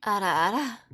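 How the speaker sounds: ada ada, sung out with a lift; performed on request. Shown as a demonstration. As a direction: do the little ada ada bit as asked. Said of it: "You want me to ada ada?".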